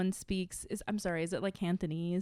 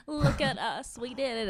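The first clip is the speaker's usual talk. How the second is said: corny teary self-quote, pitched higher; tearful; 5 semitones higher than usual. Retold or imitated. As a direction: gush it through tears, half mocking yourself. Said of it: "I started crying and now I'm like".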